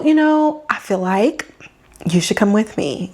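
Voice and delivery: ordinary talk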